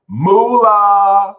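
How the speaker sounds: loud, high, drawn-out whoop; excited cheer. Real, not acted. Tarot reader hailing a card of money, excited cheer. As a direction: belt it out in celebration.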